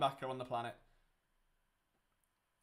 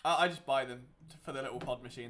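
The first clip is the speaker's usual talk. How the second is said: normal talking voice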